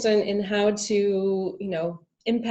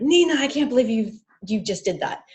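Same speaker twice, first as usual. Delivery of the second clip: scolding myself; 4 semitones higher, 4 dB louder than usual. Retold or imitated. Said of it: "I'll be like".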